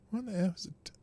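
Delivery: puzzled whisper to himself. Real, not acted. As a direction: breathe it out, no voice.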